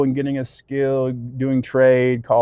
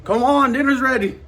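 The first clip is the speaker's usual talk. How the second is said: high raised calling voice; yelling across the room; 10 semitones higher than usual. Real, not acted. Calling child to dinner.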